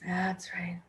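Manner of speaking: soft whispered praise. Acted. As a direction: breathe the words, barely voiced.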